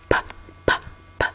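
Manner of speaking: "p, p, p" as separate voiceless pops; popping P sound. Shown as a demonstration. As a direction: pop out each "p" with breath only, like soda bubbles. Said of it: "and the soda pop".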